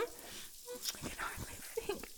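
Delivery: breathy whisper trailing off. Acted. Cat girl overwhelmed by master's hands, whispering. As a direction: lose the voice into a whisper. Overwhelmed while being kneaded.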